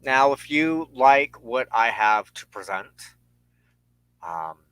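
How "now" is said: ordinary talk, nothing marked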